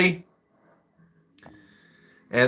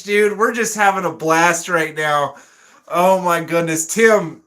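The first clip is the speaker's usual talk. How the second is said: ordinary talk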